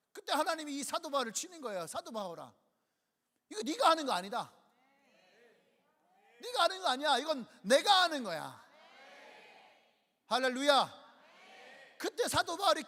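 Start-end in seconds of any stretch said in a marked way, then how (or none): 1.9-8.6 building to a loud commanding shout; God's booming rebuke. Acted. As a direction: thunder it down as God correcting Paul. God speaking to Paul. (바울을 꾸짖는 하나님의 외침)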